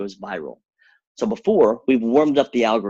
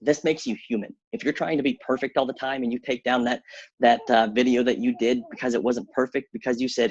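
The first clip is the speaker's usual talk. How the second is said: usual speaking voice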